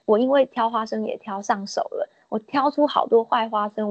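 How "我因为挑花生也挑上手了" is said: ordinary delivery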